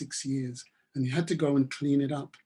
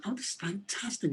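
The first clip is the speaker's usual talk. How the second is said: a hushed, breathless whisper; awed whisper; 5 semitones higher, 8 dB quieter than usual. A narrator's reading. Farmer to the boy who found his watch, awed whisper.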